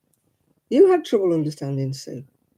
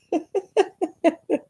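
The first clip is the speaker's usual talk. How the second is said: high-pitched giggling laugh; amused laugh; 13 semitones higher than usual. Real, not acted. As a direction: burst into high, tickled laughter. Laughs at imagined confused Miguel.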